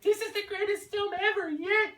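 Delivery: mock a clueless fan's praise. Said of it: "somebody out there is like".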